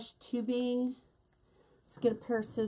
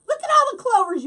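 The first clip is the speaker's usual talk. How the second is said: excited; 13 dB louder, faster than usual. Real, not acted. Reacting to clover comments.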